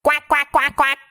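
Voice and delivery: duck quack. Pretend. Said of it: "makes the sound".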